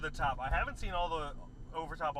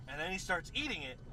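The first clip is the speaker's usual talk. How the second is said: ordinary talk, nothing marked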